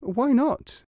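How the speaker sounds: high-pitched surprised question. A narrator's reading. Puzzled hedgehog questioning the fox, surprised. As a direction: ask it in surprise, pitch leaping up. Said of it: "The hedgehog was surprised".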